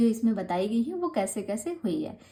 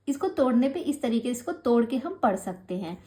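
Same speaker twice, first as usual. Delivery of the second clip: normal talking voice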